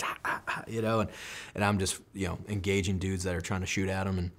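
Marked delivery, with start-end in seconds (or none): 0.0-0.6 panting runner. Retold or imitated. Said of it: "He's like".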